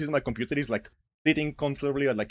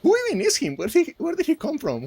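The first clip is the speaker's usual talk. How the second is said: high, loud disbelieving outburst; who even is this guy, said in disbelief; incredulous; 14 semitones higher, 5 dB louder than usual. Real, not acted. Incredulous outburst about Seagal.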